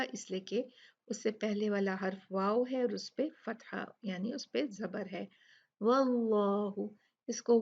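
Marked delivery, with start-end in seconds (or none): 5.8-6.9 slow वल्लाहु with a thick, dark l and long vowel; heavy lam. Shown as a demonstration. Teacher reciting Allah's name, heavy dark lam in वल्लाहु. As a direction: thicken the double l in वल्लाहु, round aa. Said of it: "लाम को मोटा पढ़ा जाएगा".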